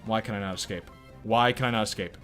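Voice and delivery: ordinary delivery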